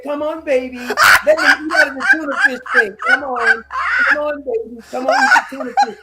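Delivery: mock cat-lady coo. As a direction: coax the cats like a fussy cat lady. Mocking Lindsey coaxing cats.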